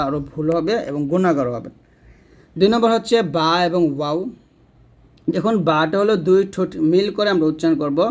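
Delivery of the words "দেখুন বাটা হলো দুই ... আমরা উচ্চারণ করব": ordinary delivery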